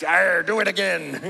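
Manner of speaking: high, loud mock pirate threat; comic pirate challenge; imitated pirate. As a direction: growl an arr, then dare them, hammed up. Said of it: "The pirate isn't doing anything".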